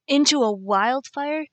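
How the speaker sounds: loud, slow, incredulous; angry disbelief. Acted. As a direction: drag it out in furious disbelief. He confronts her at fire.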